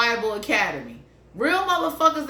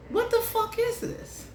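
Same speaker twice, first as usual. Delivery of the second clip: high baffled; 11 semitones higher, 5 dB quieter than usual. Retold or imitated. Mocking a clumsy lover.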